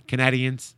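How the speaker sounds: "Canadians" with second syllable as "nad"; odd pronunciation. Real, not acted. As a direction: say "Canadians" as ca-NAD-ians, short a. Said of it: "I call it Canadian".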